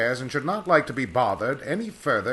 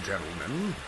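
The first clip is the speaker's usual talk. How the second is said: usual speaking voice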